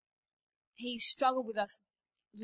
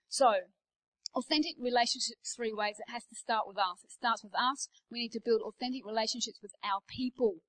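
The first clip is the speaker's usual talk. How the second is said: ordinary talk, nothing marked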